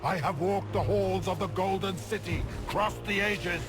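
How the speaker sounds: high, strained cry to the gods; desperate plea. Acted. Beaten villain beseeches the Ancient Ones, desperate. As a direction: beg wildly as defeat closes in.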